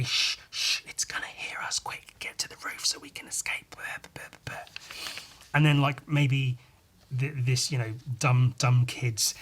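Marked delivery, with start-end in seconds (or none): 0.0-4.7 urgent, secretive stage whisper; hushed whisper. Acted. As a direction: whisper as if hiding from a monster. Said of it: "they're trying to be very, very quiet".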